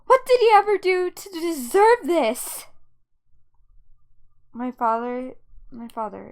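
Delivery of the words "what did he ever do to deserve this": high, loud outraged demand; raised angry voice. Acted. Daughter defending grandfather to dad, angry outburst. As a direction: cry out in indignation. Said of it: "Do not raise your voice".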